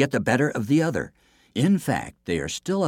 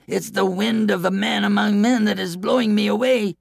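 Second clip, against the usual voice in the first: much higher, panicky coward voice; squealing coward; frightened cry; 12 semitones higher than usual. Acted. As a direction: cry out in fear. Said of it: "cried the coward".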